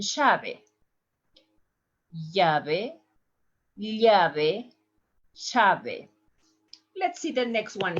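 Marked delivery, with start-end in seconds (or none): 0.0-0.6 chave, llave with a sh, "shave"; Argentine. Shown as a demonstration. Teacher demos Argentine key. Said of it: "Listen the pronunciation". 2.3-6.1 llave, llave, chave, y then sh; three accents. Shown as a demonstration. Teacher repeats key in three accents. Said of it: "Listen the pronunciation".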